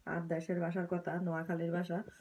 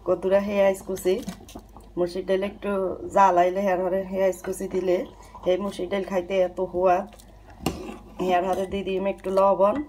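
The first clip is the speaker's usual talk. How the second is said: loud; 10 dB louder than usual. Real, not acted. Cooking over noisy stove.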